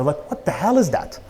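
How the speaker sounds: ordinary delivery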